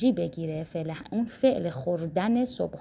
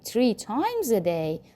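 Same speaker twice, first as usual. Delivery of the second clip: slow model phrase; much slower than usual. Shown as a demonstration. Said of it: "model phrase".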